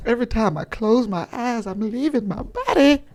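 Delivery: hammed-up high-pitched wail; comic overwrought confession; mock distress.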